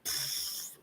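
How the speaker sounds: ordinary talk, nothing marked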